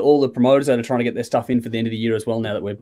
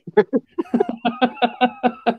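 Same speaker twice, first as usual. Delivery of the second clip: long, high giggle; real, high-pitched cackling laughter; 9 semitones higher than usual. Real, not acted. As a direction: dissolve into a long run of high chuckles. Cracking up at own comeback.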